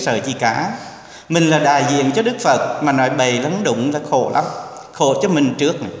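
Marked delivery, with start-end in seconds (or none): none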